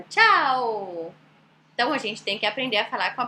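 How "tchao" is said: long sing-out Italian greeting; upbeat and cheerful. Retold or imitated. Teacher showing how Italians say it, upbeat stretched ciao. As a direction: call it cheerily, falling slowly to the O. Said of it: "Bem pra cima".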